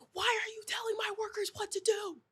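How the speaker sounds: high, strained, breathy mock yell; imitating the boss shouting across the set; mock yell from across the room. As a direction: squeeze out a high pitched imitation of his yell. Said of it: "he's yelling at me".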